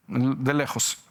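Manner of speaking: usual speaking voice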